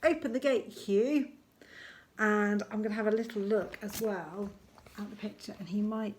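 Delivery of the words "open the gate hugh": raised, higher call to a friend; character calling out. Acted. Lanky Len to his friend Hugh, calling out. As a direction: call out the instruction, a bit louder.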